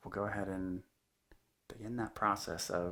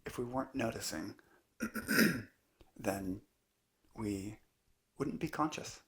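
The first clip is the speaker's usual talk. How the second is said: unhurried meditation teacher, long pauses between phrases; calm, measured guidance in short spaced phrases; guided meditation; 3 dB quieter than usual. Acted. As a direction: let each phrase settle into a long silence. Leads a guided meditation talk.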